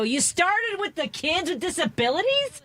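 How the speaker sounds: high, drawn-out disbelieving question; incredulous challenge. Retold or imitated. Host retelling her challenge to a representative over cuts, incredulous.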